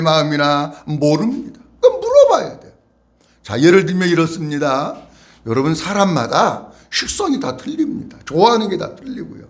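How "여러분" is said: normal talking voice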